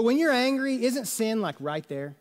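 ordinary delivery

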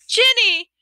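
high, loud shout of relief; overjoyed cry. Acted. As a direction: cry out her name in joy. Ron sees his sister alive.

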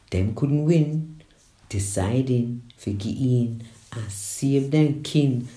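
ordinary delivery

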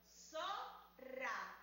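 "zorra" said as zo-rra with a pause; split into syllables. Shown as a demonstration. Teacher splits "zorra" into syllables for children. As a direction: separate "zorra" into two syllables. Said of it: "Dividámoslas en sílabas".

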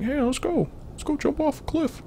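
put-on high, eager child voice; a kid cheerfully urging the family toward the cliff; little kid voice. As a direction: chirp it like a little kid tugging along. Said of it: "that's a little kid, like, screaming".

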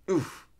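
short high oof; acted grunt from a gut punch; sudden high oof, cut short; punched-in-the-belly oof. A narrator's reading. Punched in the belly mid-sentence.

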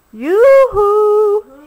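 high, loud sing-song call. A narrator's reading. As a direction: hail someone far off. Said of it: "he called".

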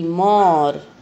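मोर with a silent final E; correct pronunciation. Shown as a demonstration. Teacher models the right word, मोर with no E sound. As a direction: say मोर, ending on the R. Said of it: "मोरे नहीं बोलते".

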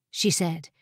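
ordinary delivery, nothing marked

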